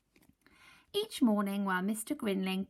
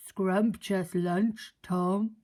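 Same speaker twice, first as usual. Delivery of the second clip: slow, loud greedy gull; seagull voice; 5 dB louder, much slower than usual. Acted. Seagull enjoying stolen lunch, slow and loud. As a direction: savour every word.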